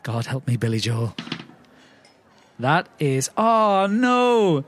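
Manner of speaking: normal talking voice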